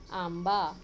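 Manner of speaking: आंबा loud, with a clear m before बा; m sound shown. Shown as a demonstration. Teacher showing children the anusvara, आंबा with emphatic m. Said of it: "म pronunciation आया यहाँ पे अनुस्वार का".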